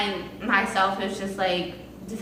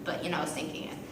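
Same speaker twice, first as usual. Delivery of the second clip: whispered aside; 3 semitones lower, 10 dB quieter, much faster than usual. Real, not acted.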